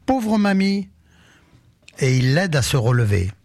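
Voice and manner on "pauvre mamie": put-on high, bright child's exclamation of pity; child's cry. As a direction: cry out like a kind little boy. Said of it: "Petit Pierre s'écrie". (voix d'enfant aiguë qui s'écrie avec pitié)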